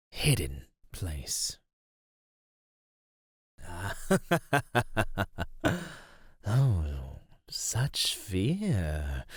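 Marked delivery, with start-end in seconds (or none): 3.6-6.0 long rippling villain laugh; menacing chuckle. Acted. Creature amused by such fear, menacing laugh. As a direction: chuckle slowly at trapped prey.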